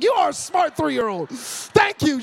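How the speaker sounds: high, loud delighted praise; proud dad praising his three-year-old. Retold or imitated. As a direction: gush over the clever kid. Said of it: "I was like".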